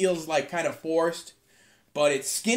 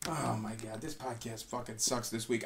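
low, soft, drained grumble; exhausted; 8 semitones lower, 13 dB quieter than usual. Real, not acted. Host admitting the podcast sucks this week, exhausted. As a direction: let the energy drop right out, very quiet. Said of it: "exhausted from cleaning".

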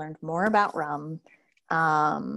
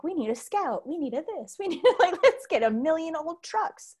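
mock excited husband; 11 semitones higher than usual. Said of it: "I'm like".

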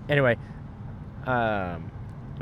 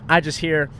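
normal talking voice

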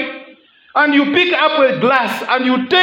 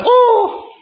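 mock eager oh; 13 semitones higher than usual. Mocking eager wife answer.

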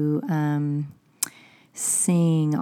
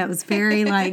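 ordinary talk, nothing marked

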